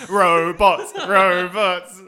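loud laughing repeat. Real, not acted. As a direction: laugh the word out. Laughing over the robot joke.

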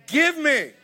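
grab-it voice of a taker; greedy demand. Pretend. New believer demanding from God, greedy demand.

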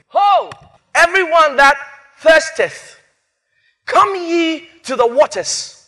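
high, loud, stretched proclamation; rising herald cry on Ho; slow intoned reading. Shown as a demonstration. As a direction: call out the invitation, drawing each word out. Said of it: "I'll read it again".

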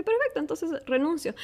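high-pitched, defiant perfecto, entonces renuncio; defiant quitting. Retold or imitated. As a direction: toss it off like the final word.

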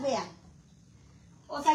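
ordinary delivery

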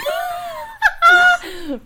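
high, loud shrieking laugh. Real, not acted.

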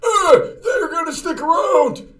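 put-on high, strained cranky old man. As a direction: shout it like a whining grandpa. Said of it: "'Cause that's what you sound like, 'cause remember, you're old".

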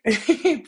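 short laugh. Real, not acted. Laughs at her own slip.

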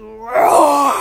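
loud, drawn-out roaring word; growling, booming onomatopoeia; lion's roar. A narrator's reading. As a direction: roar the word like a hungry lion. Narrator acts out the lions' roar.

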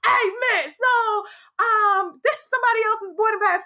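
high, rushed, half-laughing complaint; getting mad. Retold or imitated. Telling the agent she got the wrong pass, high and agitated. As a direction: pitch it up high and hurry it. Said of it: "'cause now I'm getting mad".

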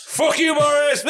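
mock furious shout at Boris; heckling shout. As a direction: bellow it at the screen. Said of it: "Just like".

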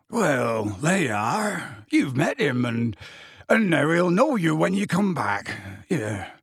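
halting, thick-voiced character speech; mildly stuffed-up, hesitant delivery; Hagrid speaking thickly. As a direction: talk as if pinching a bleeding nose. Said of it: "said Hagrid thickly".

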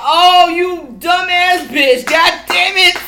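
loud, slow exasperated cursing at a dead battery; loud exasperated swearing; frustrated cursing. Retold or imitated. Cursing dead vibrator, retold.

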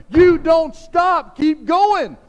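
preacher's shout, slow and high. Real, not acted. Preacher shouting at parents to never stop. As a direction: roar it out to the congregation.

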